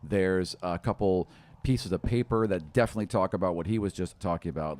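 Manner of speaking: usual speaking voice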